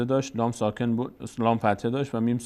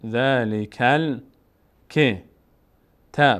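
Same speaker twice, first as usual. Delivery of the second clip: slow spelled reading; 4 dB louder, much slower than usual. Shown as a demonstration. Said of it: "demonstrates reading letter by letter".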